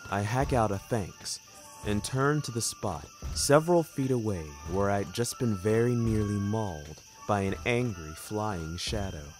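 ordinary delivery, nothing marked

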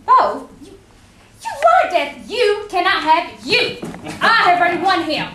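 loud, slow protest against Death; defiant outcry. Acted.

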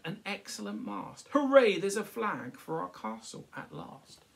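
excited dad. Acted. Dad cheering.

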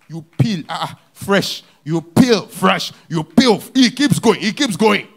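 loud building preaching; rising sermon cry. Acted. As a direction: hammer each peel. Preaching at full force.

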